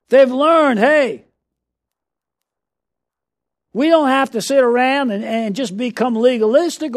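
fervent revival preacher building to a shout; full-force preaching. Acted. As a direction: preach it to the rafters, rising to shouts.

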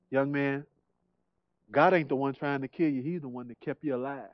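very soft, low voice of a preacher; gentle reassurance. Retold or imitated. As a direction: quietly reassure a troubled young man, pitched low. Preacher gently answers troubled young man.

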